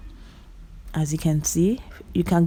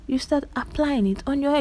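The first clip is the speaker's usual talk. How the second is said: lifted, softer sing-song repeat of the step; higher sing-song repeat; 8 semitones higher, 4 dB quieter than usual. Shown as a demonstration. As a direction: lilt it up softly like a singsong reminder.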